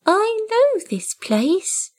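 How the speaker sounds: a kitten waking to a place it knows; newborn kitten voice. A narrator's reading. Tiny kitten, high and slow in wonder.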